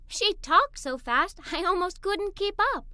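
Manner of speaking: ordinary delivery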